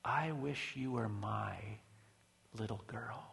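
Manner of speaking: hushed, slow and tender, nearly whispering; gently imitated whisper. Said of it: "Missus Leonard whispered across the door".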